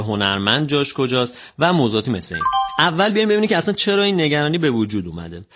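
normal talking voice

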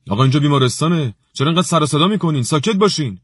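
stern rebuke. A narrator's reading. The director scolds Ali for shouting, fast and stern. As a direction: tell them off fast for the noise.

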